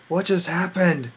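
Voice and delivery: slow, bewildered question; confused ponies. Retold or imitated. Ponies after the cake vanished, slow bewildered question. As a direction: ask as if the lights just died. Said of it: "everyone's like".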